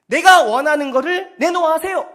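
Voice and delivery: loud, high-pitched entitled demand; mock a pushy worshipper claiming what's owed. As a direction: insist loudly on getting your due. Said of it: "이들의 방식이에요".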